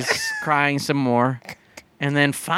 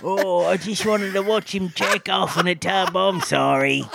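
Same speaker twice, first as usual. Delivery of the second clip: high sheepish apology; 3 semitones higher than usual. Pretend. Voicing caught peeper.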